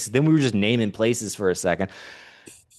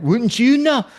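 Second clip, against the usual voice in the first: high, loud mock heckle; imitated rowdy stranger; 6 dB louder, slower than usual. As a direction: throw it out like a heckler.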